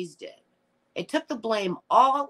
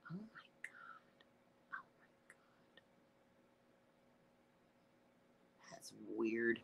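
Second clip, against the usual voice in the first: breathless whisper, no voice at all; whispered shock; 7 semitones lower, 19 dB quieter than usual. Real, not acted. Reacting to a chat comment in a whisper. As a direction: whisper it like you're stunned by a secret.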